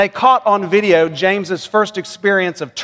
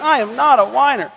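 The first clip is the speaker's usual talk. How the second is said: exaggerated mock whine, much higher; whiny little boy; 7 semitones higher than usual. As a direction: whine it like an indignant small child. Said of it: "said it in a whiny voice".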